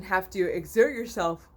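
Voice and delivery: talking through a yawn; words stretched by a yawn. Real, not acted. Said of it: "I'm so sorry".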